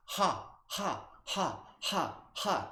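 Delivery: high breathy ha sounds on the exhale; laughter exhale demo. Shown as a demonstration. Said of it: "focus on the exhale sounding like this".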